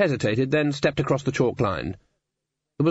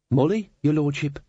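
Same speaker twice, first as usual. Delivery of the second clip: timid maid, a little higher; slightly trembling maid; 5 semitones higher than usual. A narrator's reading. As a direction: answer his lordship nervously. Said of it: "trembling slightly".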